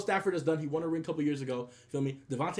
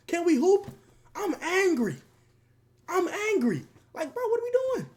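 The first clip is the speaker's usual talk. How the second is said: hammed-up mock anger, much higher; 13 semitones higher, 4 dB louder than usual. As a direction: whine it like a joking fake tantrum.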